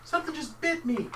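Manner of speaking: high pained yelps, hammed up; screaming in pain. Swimmer screaming after being bitten in the water. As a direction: yelp like something bit you. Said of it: "you hear somebody screaming".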